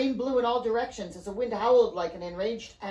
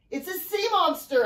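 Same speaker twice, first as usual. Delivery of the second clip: high-pitched yell of warning; alarmed yell; 9 semitones higher, 3 dB louder than usual. A narrator's reading. Alfie identifies the creature, alarmed yell. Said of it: "Alfie yelled".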